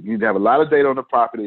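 fast rushed repeat. Shown as a demonstration. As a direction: rattle it off quickly. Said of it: "you just do".

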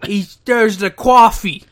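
put-on slow, loud caricature New York accent; mock New York accent. Co-host spoofing the wrestler's coffee line in loud mock New York. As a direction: stretch it out in exaggerated New York. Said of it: "a New York accent".

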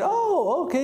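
mock surprise. [name] feigns mild surprise.

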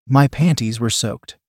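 ordinary delivery

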